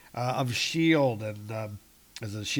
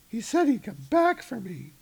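higher, wistful Jane voice; a lightly voiced Jane; Jane's voice; 9 semitones higher than usual. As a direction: lift it up for a pining Jane.

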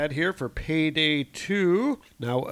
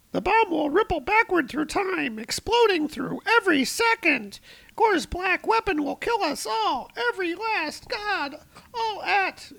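very high, squeaky frantic voice; panicky Shadrach. Acted. Shadrach warning the librarian, high frantic squeal. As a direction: shriek it like a panicking tentacle god. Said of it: "Shadrach is like".

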